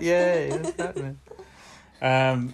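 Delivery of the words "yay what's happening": higher cheerful yay, a bit slow; cheery Sarah. Retold or imitated. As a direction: chirp it out cheerfully. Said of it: "She said that".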